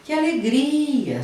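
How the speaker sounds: high, slow, delighted; light up the words with joy; joyful exclamation. Shown as a demonstration. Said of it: "dependendo da situação". (alegria encenada, aguda e lenta)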